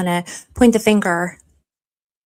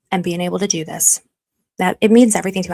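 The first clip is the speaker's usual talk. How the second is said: usual speaking voice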